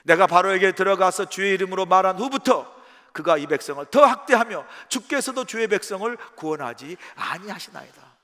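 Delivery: ordinary delivery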